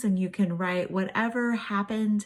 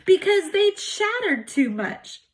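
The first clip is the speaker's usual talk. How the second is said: laughing; 9 semitones higher, 5 dB louder than usual. Acted. Funny punchline of duck poem.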